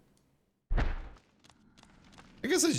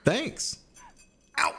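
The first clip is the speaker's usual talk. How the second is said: squeaky pretend ouch; high mock pain voice; 13 semitones higher than usual. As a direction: whine high about the bones. Hurt, playful complaint.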